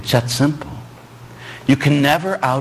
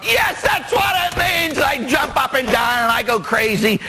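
high, shouted triumph, jumping up and down; a high shouting burst of glee; excited shout; 13 semitones higher than usual. Retold or imitated. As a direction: yell it out like you just won. Priest jumps up shouting.

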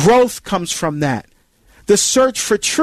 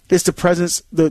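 ordinary talk

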